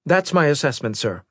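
brisk officer's reply, pitched high. A narrator's reading. Officer confirming her assessment to the admiral, brisk. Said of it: "the intelligence officer said briskly".

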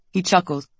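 ordinary delivery

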